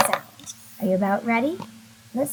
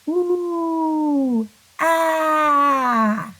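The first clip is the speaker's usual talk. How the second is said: high ooh then louder ah; 5 semitones higher, 4 dB louder than usual. Shown as a demonstration. Teacher leading the class in ooh and ah, high and drawn out. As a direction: sing ooh, then open to ah. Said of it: "Let's try ooh and ah".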